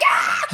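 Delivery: high gleeful shriek; acted-out fan squeal; excited mock scream. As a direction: scream in celebration with laughter. Acting out screaming in the elevator.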